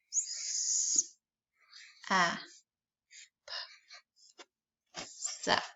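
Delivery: unvoiced S-A-P then Sap; whispered sounding out. Shown as a demonstration. Teacher whispering S-A-P while writing. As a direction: breathe each sound, then the word. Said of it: "Let me see what I can write for that".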